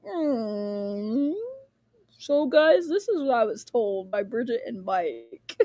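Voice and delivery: low, smug imitation of someone explaining; mock confident voice. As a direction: play it like a self-assured know-it-all. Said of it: "That confidence was like".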